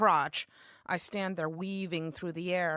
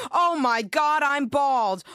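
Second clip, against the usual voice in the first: loud, high horrified wail; shocked cry; 5 semitones higher, 7 dB louder, slower than usual. Retold or imitated. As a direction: shriek in horror at the mirror. Sees bald head in mirror.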